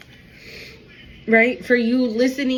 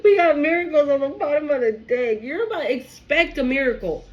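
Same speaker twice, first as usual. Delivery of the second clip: emotional, high voice breaking into a cry with a laugh; real tears spilling over; sobbing laughter through joy; 4 semitones higher than usual. Real, not acted. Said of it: "so freaking emotional".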